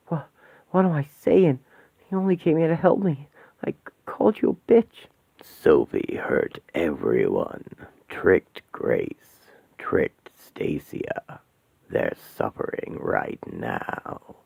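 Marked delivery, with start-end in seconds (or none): 5.4-14.3 very low, slow, hollow demonic voice. Acted. Demon Asmodeus greeting the trapped women, low and menacing. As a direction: loom over them like a demon.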